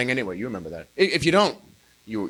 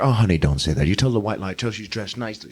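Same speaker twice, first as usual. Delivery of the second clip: a hint of a gentle, lower mom; lightly voiced mother; 9 semitones lower than usual. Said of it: "your mother will say".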